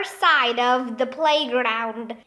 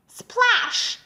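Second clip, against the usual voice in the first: high-pitched exclamation; exclaimed sound effect; 8 semitones higher than usual. A narrator's reading. Narrator voices a splash into pool.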